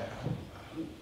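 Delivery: very soft, halting near-whisper; hushed, stunned stammer; dumbfounded Peter. Acted. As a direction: stammer it under the breath, at a loss. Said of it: "Peter's just like".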